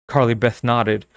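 plain reading voice

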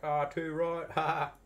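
loud, high Aussie agreement; very Aussie accent. Pretend. Coworkers agreeing with the speaker, broad Aussie accent. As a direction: agree heartily like a hammed-up Australian. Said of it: "say it with an Aussie accent".

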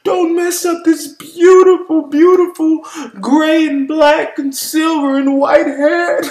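hammed-up vain hair complaint; imitating a vain friend. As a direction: whine like someone guarding their perfect hair.

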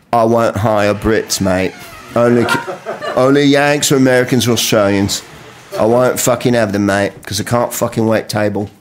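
lightly voiced Aussie "mate" accent; blunt Australian boss talking; mild Australian accent; Australian manager. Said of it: "the manager came up to me and went".

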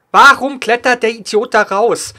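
slow angry yell, stress on Warum; man down shout. Real, not acted. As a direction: drag out the angry question. Repeats the same complaint.

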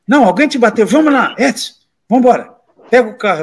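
high-pitched acted agitation; rally others to go settle a score; excited mock call to action; protective urgency, retold. Protective men rallying to retaliate.

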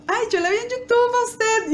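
a hint of a chirpy professor; teacher's voice. Professor's surprising remark.

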